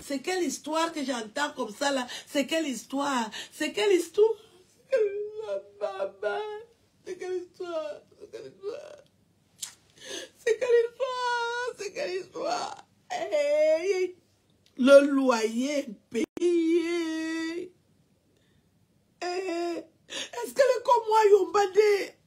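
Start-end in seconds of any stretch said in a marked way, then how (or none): 3.5-12.9 high, wailing mock sobs; mock crying. Mocking the woman who cries to her mother, in hammed-up sobs. 13.1-22.1 mock sobbing. Parodying the crying woman over the rent, in slow mock sobs.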